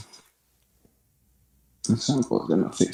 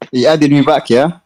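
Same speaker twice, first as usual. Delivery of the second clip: surprised loud; 4 semitones higher, 8 dB louder than usual. Real, not acted. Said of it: "caller re-added to the stream".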